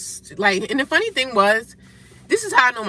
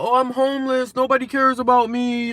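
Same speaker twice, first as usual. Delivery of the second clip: whiny mock lament; 3 semitones higher, 4 dB louder than usual. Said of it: "sit around crying and whining about".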